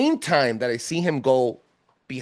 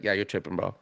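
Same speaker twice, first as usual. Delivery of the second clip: inner voice; 4 semitones lower, 7 dB quieter, faster than usual. Retold or imitated. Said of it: "your voice tells you".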